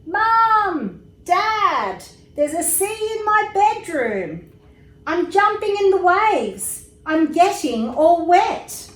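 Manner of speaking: high, loud, thrilled shouting; child shouting to his parents about the sea; excited shout. A narrator's reading. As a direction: yell with pure delight.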